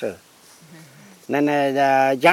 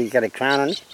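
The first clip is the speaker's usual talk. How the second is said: usual speaking voice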